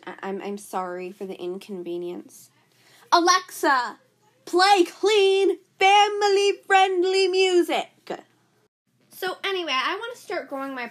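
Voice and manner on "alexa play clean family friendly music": loud, high command to the speaker; calling to Alexa. Real, not acted. Loud call to Alexa over the rap music. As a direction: shout the order across the room.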